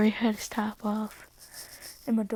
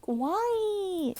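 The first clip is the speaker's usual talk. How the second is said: put-on high squeaky whine of a dumped girlfriend; a high obnoxious whine; whiny girl voice; 10 semitones higher than usual. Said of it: "She's like".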